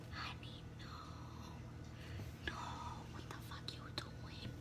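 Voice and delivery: sharp hushed whisper; shocked whisper aside. Real, not acted. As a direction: hiss it under your breath. Whispered reaction to off-camera.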